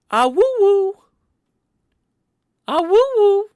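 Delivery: high, loud "ah-woo-woo" howl; wolf howl attempt. Testing whether a wolf howl is close, mock howling. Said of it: "Is that, is that close?".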